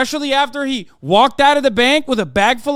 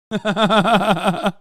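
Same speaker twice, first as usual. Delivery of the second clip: laugh; 3 semitones lower than usual. Real, not acted. Laughing after his own rant.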